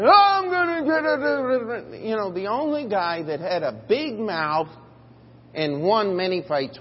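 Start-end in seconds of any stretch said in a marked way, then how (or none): 0.0-2.0 high, slow, hammed-up boast; mock braggart. Preacher mocking a big-mouthed bragger with a high slow boast. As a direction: ham up a loudmouth bragging. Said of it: "somebody who said".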